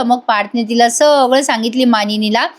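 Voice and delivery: ordinary talk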